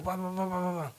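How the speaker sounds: soft rattled-off "pa pa pa"; quick "pa-pa-pa" noise. Retold or imitated. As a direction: imitate a motor getting going, softly. Imitating how they took off. (pa, pa, pa imitado, bajito)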